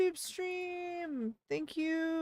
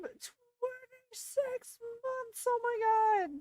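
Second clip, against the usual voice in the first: amazed; 10 semitones higher, slower than usual. Real, not acted. Amazed at resub length.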